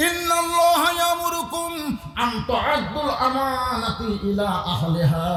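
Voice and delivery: high chanted Quranic verse; melodic recitation. Acted. Preacher quoting Omar reciting Quran, high melodic recitation. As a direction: intone it high, drawing out the endings.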